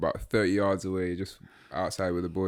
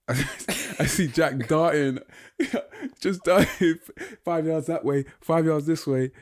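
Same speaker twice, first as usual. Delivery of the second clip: higher, louder, breaking into laughter; laughing retelling; 7 semitones higher, 4 dB louder, slower than usual. Real, not acted. Teasing a friend about his panicked search, laughing as he talks. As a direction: tell it through real laughter.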